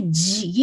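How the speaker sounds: جی with a leaking, buzzing j; wrong jeem sound. Shown as a demonstration. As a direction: let the j of جی spill forward. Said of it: "یہ والی sound نہیں آئے گی". (جی کی غلط آواز)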